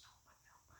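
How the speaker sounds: breathy whisper, no voice at all; a soft voiceless cutoff. Retold or imitated. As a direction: hush him with a whispered no. Lady cuts him off.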